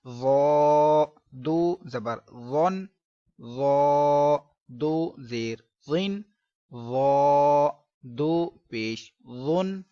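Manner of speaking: slow drill with full heavy वो; heavy zoye. Shown as a demonstration. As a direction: draw out a thick heavy वो. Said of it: "इसको मोटा पढ़ना है".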